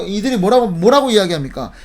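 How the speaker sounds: usual speaking voice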